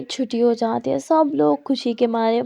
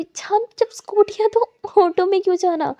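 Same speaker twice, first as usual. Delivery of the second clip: loud protest; 8 semitones higher, 4 dB louder than usual. Acted. Protesting friend takes auto.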